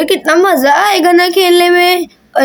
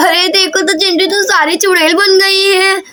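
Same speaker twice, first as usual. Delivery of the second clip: normal talking voice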